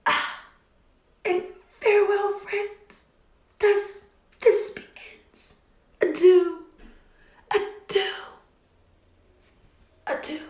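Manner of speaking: dying gasps. Acted. Thisbe stabs herself, dying.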